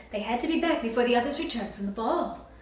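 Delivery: ordinary delivery, nothing marked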